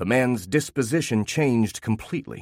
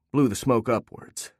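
usual speaking voice

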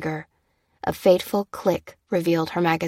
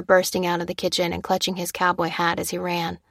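usual speaking voice

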